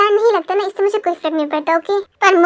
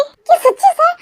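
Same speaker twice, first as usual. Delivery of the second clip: surprised; 10 semitones higher, 4 dB louder, slower than usual. Acted. Students surprised at principal.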